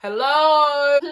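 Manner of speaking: re-enacted call for someone to answer; shouting. Still calling round the empty bank, shouting. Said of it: "shouting around the bank like".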